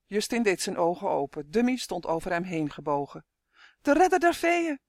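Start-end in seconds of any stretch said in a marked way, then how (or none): 3.8-4.8 high, loud jubilant cheer. A narrator's reading. Twin hails the fairies' rescuer, cheering. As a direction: hail a hero with a cheer. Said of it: "juichte Tummy".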